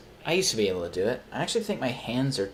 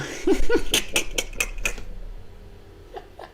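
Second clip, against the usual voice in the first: real breathy high laugh; 5 dB louder than usual. Real, not acted. Laughing at his own Midwest bit, high and breathy.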